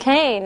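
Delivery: "cane" with the A saying its name; long vowel. Shown as a demonstration. Teacher showing what the added E does, long vowel. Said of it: "It's saying the long vowel sound".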